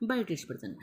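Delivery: ordinary delivery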